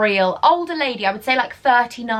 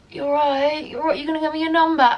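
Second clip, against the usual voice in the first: higher, slurred mock chat-up voice; gurning drunk men; 5 semitones higher than usual. Drugged men chatting up the door girl, mocking gurning impression. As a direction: put on a wasted lad pestering for a number. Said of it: "And they're gurning".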